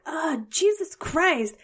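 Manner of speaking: drawn-out retold oath; exasperated curse. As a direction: act out swearing in the car. Retelling cursing while driving.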